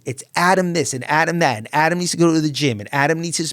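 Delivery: high, loud exasperated rant by wife; wife's exasperated rant. Retold or imitated. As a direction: vent years of frustration, mimicking her. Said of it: "there was this, laughs".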